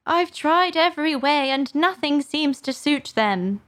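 high, lightly sobbing lament; kind of sob. A narrator's reading. Pigeon bemoaning its troubles, mildly sobbing. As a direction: complain high, with a touch of despair. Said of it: "added with a kind of sob".

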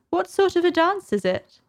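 put-on high, light girl's voice; a curious young girl asking; Alice voice. Alice asks the creatures about the dance, high and light.

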